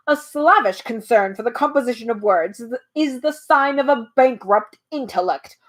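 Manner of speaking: plain reading voice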